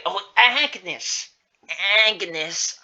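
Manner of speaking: loud, high, drawn-out name; animated greeting. Acted. Beth greeting client Agnes, animated.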